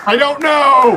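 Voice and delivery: loud, high laughing; laughing shout. Real, not acted. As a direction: burst out laughing. Laughing at the skipping clip.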